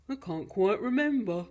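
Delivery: monster dad trying to recall; Gruffalo father. Acted. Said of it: "the Gruffalo said".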